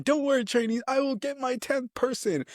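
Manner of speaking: a hint of a high sergeant voice; lightly voiced drill sergeant. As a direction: boast like a sergeant. Sergeant vowing another crier.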